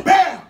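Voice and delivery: high, loud punched "bam"; crash noise. Retold or imitated. Couple rolls off bed mid-sex, loud crash word. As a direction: punch it out as an impact.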